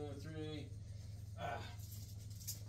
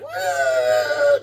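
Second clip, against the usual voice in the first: high, loud "woo" of a flying ball; mouth-made whoosh sound effect; imitated ball whoosh; 4 semitones higher, 6 dB louder than usual. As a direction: send the ball soaring with your voice. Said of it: "They have the sound effect like".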